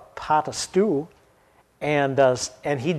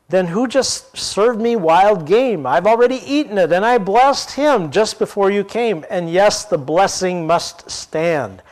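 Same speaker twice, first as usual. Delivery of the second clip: put-on higher, louder, shaken old father; trembling; 5 semitones higher, 4 dB louder than usual. A narrator's reading. Blind father realizing he was tricked, shaken and raised. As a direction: let the panic rise in his voice. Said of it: "Isaac began to tremble uncontrollably".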